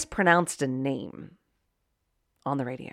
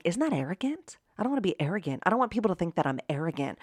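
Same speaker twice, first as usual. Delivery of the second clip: softer, quicker imitation of a worrier; quoted timid worry; 7 dB quieter, faster than usual. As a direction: voice others' worry in a small, soft voice. Quoting worried people.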